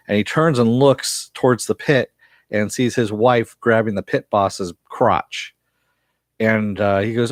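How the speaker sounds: ordinary talk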